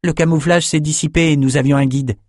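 put-on young man boasting of a marvel; eager young Bannon. Bannon telling drinkers about the archives, eager high voice.

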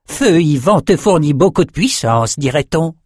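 high, lilting, delighted remark; gleeful André. A narrator's reading. André marvels at Nathan's borrowed power, high gleeful lilt. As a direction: keep the gloating sorcerer's high swoops.

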